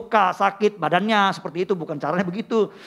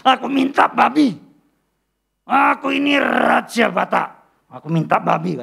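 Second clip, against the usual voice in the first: 1 demon voice; 7 semitones higher, 6 dB louder than usual. Acted. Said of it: "Dia berkata".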